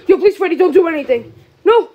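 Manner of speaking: loud, frightened begging; urgent pleading. Real, not acted. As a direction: beg the attacker to stop. Begging Freddy to stop.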